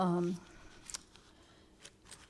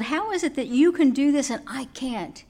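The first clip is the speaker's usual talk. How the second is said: loud, higher indignant complaint; an offended holy man, raised voice; angry grumble; 6 semitones higher, 4 dB louder than usual. Acted. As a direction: protest your own holiness angrily. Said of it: "Angry, he grumbled".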